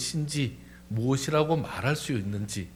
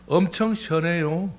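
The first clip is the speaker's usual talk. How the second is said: slow, higher Chungcheong drawl; Chungcheong dialect; 5 semitones higher, slower than usual. Shown as a demonstration. Preacher's punchline for feeling refreshed, slow Chungcheong accent. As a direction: stretch it out in Chungcheong style. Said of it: "충청도는".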